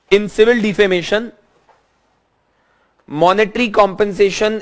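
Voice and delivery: slow, measured note-dictation delivery. Real, not acted. Teacher giving students notes to write down, slow dictation. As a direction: dictate in short phrases with long pauses.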